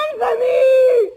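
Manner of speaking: loud, high, slow and drawn-out scream; terrified shout. Acted. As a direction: scream it out in pure terror. Demands an answer in the dark.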